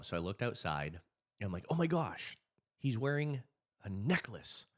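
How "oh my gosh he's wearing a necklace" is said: alarmed. Retold or imitated. Panicked retelling of intruder.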